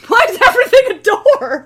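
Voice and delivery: high, loud laughing complaint; laughing disbelief. Real, not acted. Exasperated laughing at doors.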